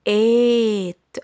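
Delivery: "إيت" with a long, loud A as in its name; long letter name. Shown as a demonstration. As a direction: stretch the vowel of "إيت". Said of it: "شوف أنا نطقت إزاي؟". ("إيت" بمد طويل)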